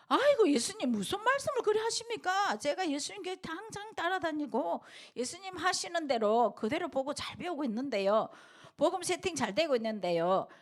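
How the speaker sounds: high, pleading protest as Peter; acted Peter protesting. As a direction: plead your loyalty as a startled disciple. (베드로가 예수님께 높은 목소리로 항변하듯)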